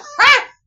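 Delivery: loud high cackle; shrieking laugh. Real, not acted. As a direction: burst of screaming laughter. After shocked quote.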